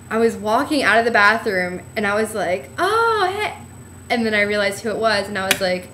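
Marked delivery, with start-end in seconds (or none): 2.8-3.5 high, startled greeting; a retold surprised hey; surprised hello. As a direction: pop up with surprise at a familiar face.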